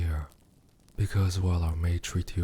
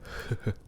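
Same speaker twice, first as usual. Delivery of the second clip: quiet breathy chuckle; soft breathy laugh; 4 dB quieter than usual. Acted. Demon pleased by the human's defiance, soft chuckle. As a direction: laugh softly to yourself.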